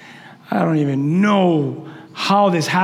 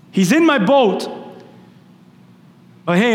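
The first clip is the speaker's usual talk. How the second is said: ordinary talk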